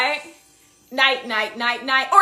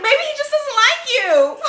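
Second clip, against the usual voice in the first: higher put-on cartoon voice; character quote; 8 semitones higher than usual. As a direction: mimic the cartoon character, pitched up. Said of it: "And then he was like".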